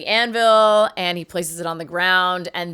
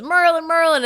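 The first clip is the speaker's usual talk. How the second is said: calling out; 8 semitones higher, 3 dB louder than usual. Retold or imitated. New king calls for Merlin.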